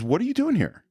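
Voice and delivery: acted-out question, pitched high on "doing here"; retold question. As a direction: lift "What are you doing here?" high, as retold dialogue. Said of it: "the next thing he asks is".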